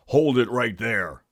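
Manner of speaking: slow, loud commanding cop voice; policeman. A narrator's reading. Policeman stopping fleeing burglars, loud command. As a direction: order them to halt in a firm character voice.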